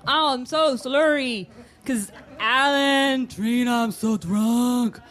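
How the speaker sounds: slow, dragged-out mock-drunk slurring; exaggerated fake slur. [name] showing friends how slurry she is, fake slur. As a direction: smear the words slowly to prove you're drunk. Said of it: "see how slurry my words are".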